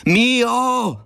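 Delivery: high, long-held shouted name; desperate high holler into the dark; calling out. A narrator's reading. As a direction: cry out for a missing companion, high and sustained. Lost narrator calls out again.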